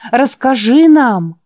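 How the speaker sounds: расскажи нам, slow and loud with a falling нам; loud pleading demand. Acted. Boys beg the preacher to explain, now shouting. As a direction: demand it at full voice.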